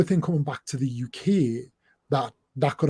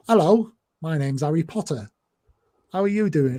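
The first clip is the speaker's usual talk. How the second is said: put-on English accent, pitched up a little; 3 semitones higher than usual. Northeasterner showing Americans their idea of English, in a mock English accent. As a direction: mimic the English accent outsiders imagine. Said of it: "what you think is the English accent".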